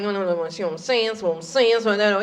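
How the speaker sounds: low, soft, unintelligible mumbling; mumbled whisper. Shown as a demonstration. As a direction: run the words together under your breath. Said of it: "we know how to whisper".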